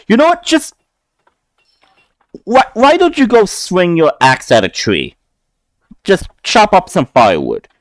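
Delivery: fed-up guard sending the orc away; frustrated Goliath. Acted. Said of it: "you can see there was frustration".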